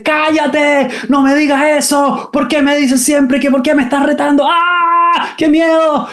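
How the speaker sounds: put-on very high, shrill overwhelmed child's outburst; child yelling back. Overwhelmed child shouts back at mother, very high and frantic. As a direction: scream at mom in panic and anger. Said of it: "le dices".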